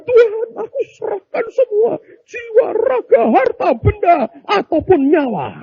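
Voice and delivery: very high-pitched, hammed-up surrender to God; desperate prayer. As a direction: act out someone in trouble crying to heaven. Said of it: "Begitu kesusahan". (doa berlebihan dengan suara melengking)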